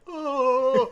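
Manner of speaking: high, loud groaning oh; mock drunk groan. Drunk friend groaning in bathroom.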